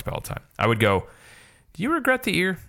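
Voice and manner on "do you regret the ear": high teasing question; mock question. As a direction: ask it in a much higher, playful voice. Imagined question to Joe Rohde.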